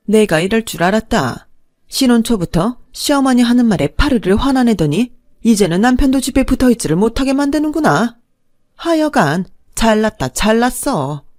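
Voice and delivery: high, loud, sharp scolding, slowing to a sneer at the end; mother-in-law blaming her daughter-in-law, high and loud, sarcastic at the end. Acted.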